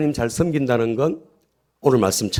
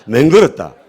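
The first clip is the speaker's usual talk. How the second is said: loud Gyeongsang dialect punchline; emphatic comic Gyeongsang delivery; 6 dB louder than usual. Shown as a demonstration. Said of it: "경상도 버전으로".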